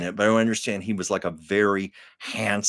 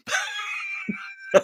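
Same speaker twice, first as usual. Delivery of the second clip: shrieking laugh. Real, not acted. Host reacting to the Thrifty check joke with a high, shrieking laugh. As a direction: howl with laughter.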